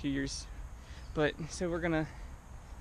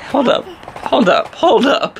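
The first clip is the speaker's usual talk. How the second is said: excited; 9 semitones higher, 18 dB louder, much slower than usual. Real, not acted. Stops to photograph eyes.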